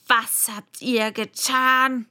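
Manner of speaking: loud, slow, accusing question; queen's demand. A narrator's reading. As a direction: demand an answer, dragging the words out. Angry queen confronts the gardeners.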